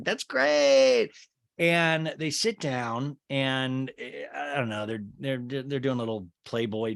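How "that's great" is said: chirpy reply. Retold or imitated. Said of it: "And Heather's like".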